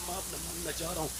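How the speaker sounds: soft, low, tearful promise; a hushed, teary child giving in; retold tearful plea. Boy crying to his mother after being hit, soft tearful promise. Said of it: "रो के बोला".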